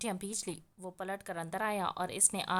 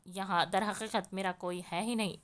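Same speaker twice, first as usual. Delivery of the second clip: ordinary delivery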